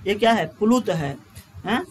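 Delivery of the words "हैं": ordinary talk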